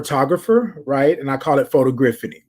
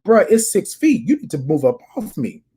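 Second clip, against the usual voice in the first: higher, pointed telling-off, slowing at the end; retold telling off; 7 semitones higher than usual. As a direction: firmly tell the man to back off. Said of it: "like I told him".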